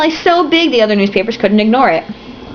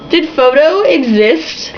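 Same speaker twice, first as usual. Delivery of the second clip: incredulous; 5 semitones higher, much slower than usual. Real, not acted. Doubting the book's anachronism.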